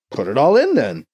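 matter-of-fact with a touch of impatience; matter-of-fact, impatient. Acted. BC answering Mbeke's objection, matter-of-fact and slightly impatient. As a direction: brush the objection aside briskly. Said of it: "says matter-of-factly, a little impatient".

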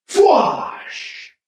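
high, airy whoosh for an energy shot; rifle blast sound. A narrator's reading. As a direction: mouth a sharp laser-gun blast. Narrator voices rifle blast sound effect.

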